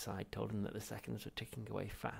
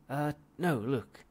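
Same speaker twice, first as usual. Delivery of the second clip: urgent; 5 semitones higher, 10 dB louder, slower than usual. Acted. Zaphod urgent.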